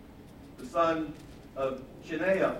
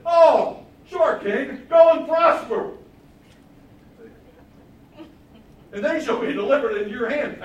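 loud, high, hammed-up false enthusiasm; mock the king with overblown agreement; sarcastic; 9 semitones higher, 14 dB louder than usual. Prophet mocking the other prophets to the king, loud sarcasm. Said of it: "he says it sarcastically".

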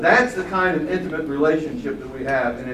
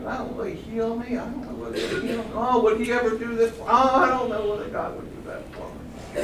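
a light, higher whiny doubter voice; doubtful believer; 7 semitones higher, 4 dB quieter than usual. Retold or imitated. Preacher mocks a doubting believer, lightly whiny. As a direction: mimic a timid unsure believer.

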